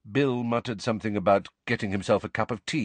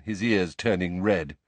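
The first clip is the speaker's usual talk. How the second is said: plain reading voice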